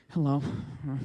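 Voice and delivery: low, deflated greeting; a small downcast hello; subdued gorilla. Acted. Gorilla greeting the sad lady, subdued. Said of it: "gorilla's energy just comes all down".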